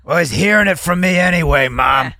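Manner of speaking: shouted retort to Mom. Retold or imitated. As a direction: fire it back at Mom.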